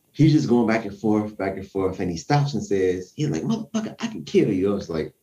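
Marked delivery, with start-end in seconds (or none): 3.4-4.7 mock high threat, half acted; quoted threat. Retelling a drunk man's threat, lightly imitated high. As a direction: snaps it out like the drunk man did.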